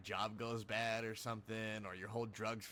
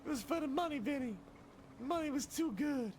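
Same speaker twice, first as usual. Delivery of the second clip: very high, loud squeal of apology; over-the-top drama, squeaky and loud; mock pleading betrayer; 5 dB louder than usual. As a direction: plead in a hammed-up falsetto wail. Betrayer makes excuses to Vinny.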